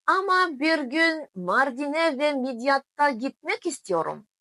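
ordinary talk

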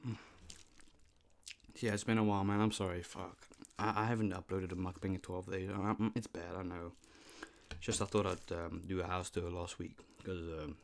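speaking with food in mouth; mumbled between bites; chewing while talking. Real, not acted. Seen 1: Ate noodles just before; twirls forkful, mouth working while speaking.

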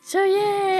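squealing happy yes; excited. Real, not acted. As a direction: gush with delight. Delight at new friend.

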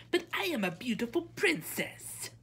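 high, loud, demanding princess; imperious princess, pitched high. A narrator's reading. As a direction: announce it like an entitled princess. Princess protests.

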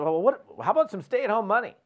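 imitating a quirky, high-pitched offer; cheeky, sing-up comic delivery; quirky and funny. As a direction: pitch it up playfully, like a goofy pitch. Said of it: "the way she said it was just kinda".

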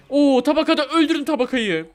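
high, loud excited callout; urgent shout. Real, not acted. Player ordering teammates to kill a target, urgent.

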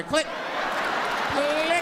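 spoken click sound, second one high; imitated hang-up click; mock phone click. Hanging up phone.

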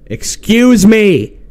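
loud, high, drawn-out "Excuse"; exasperated shout. Real, not acted. Player repeats a command the game ignores, exasperated shout. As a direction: stretch it out and yell in frustration.

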